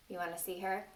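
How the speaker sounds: usual speaking voice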